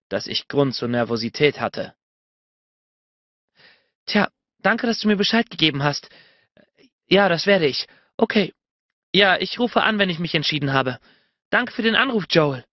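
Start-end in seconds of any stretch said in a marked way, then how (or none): 4.1-12.7 higher, polite woman's voice; woman on the phone. A narrator's reading. She thanks Joel on the phone, in a higher woman's voice. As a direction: play her politely ending the call.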